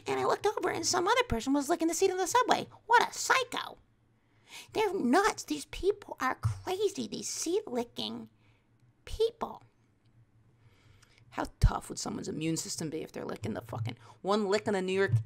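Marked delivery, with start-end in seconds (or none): 0.1-9.6 very high, indignant parody voice; judgmental mock mom. Parody mom condemning seat lickers, high mock outrage.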